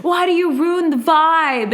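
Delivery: mock an annoyed sibling's whine; retold mad brother. Brother got mad at his sister, drawn-out mock annoyance. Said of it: "my brother got mad".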